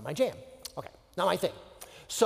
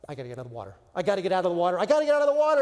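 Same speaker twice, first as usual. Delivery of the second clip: mounting panic; 4 dB louder, much faster than usual. Acted. Swimmer desperate to reach shore, panic building. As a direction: build from contained dread to open panic.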